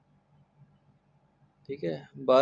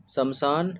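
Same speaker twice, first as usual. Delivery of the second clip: normal talking voice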